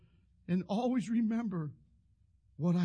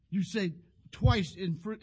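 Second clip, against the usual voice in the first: usual speaking voice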